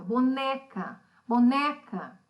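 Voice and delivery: boneca with NE punched out; stress on NE. Shown as a demonstration. Teacher helping pupils hear the stronger syllable, stress on NE. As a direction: repeat boneca, leaning firmly on the middle syllable NE. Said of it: "quando eu falo que você escuta ... mais alta".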